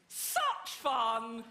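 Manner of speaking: drawn-out posh British exclamation; posh British catchphrase. Retold or imitated. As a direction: stretch it out in hammed-up posh English. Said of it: "posh phrase".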